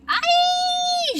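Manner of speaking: very high, loud shriek. A narrator's reading. Flap shrieking while falling in the dark, very high and loud. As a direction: let out a piercing scream. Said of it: "shrieked Flap".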